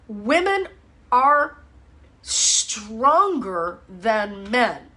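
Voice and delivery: slow, drawn out, each word landing hard; emphatic claim. Acted. Trainer telling men a point that will annoy them, slow and emphatic. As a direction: lean on "stronger" and stretch it out. Big claim delivered for effect.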